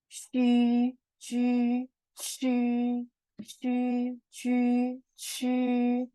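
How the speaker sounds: 虚 drawn out on a steady level tone; sustained model sounds. Shown as a demonstration. Teacher drilling learners on similar sounds, long level 虚. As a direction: hold each 虚 long, front rounded ü. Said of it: "Yang ini".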